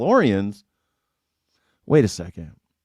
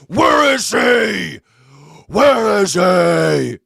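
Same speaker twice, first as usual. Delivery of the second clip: slow, loud, higher rasping Batman shout; Batman growl; 9 semitones higher, 6 dB louder, much slower than usual. Retold or imitated. Streamer showing chat his Batman voice, slow loud shout. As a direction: bark it like Batman interrogating. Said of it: "Batman's like".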